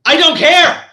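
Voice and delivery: high, loud interruption; exasperated loud cut-in; angry. Real, not acted. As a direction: cut them off hard. Cuts off other reading.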